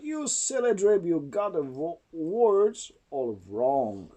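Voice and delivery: plain reading voice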